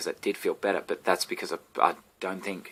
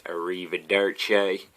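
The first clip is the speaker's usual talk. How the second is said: slow, hammed-up American stab at Italian, "Arrivederci" drawn out; bad Italian; slower than usual. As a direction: say "Arrivederci" like a clumsy undercover American. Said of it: "he walks up and he goes".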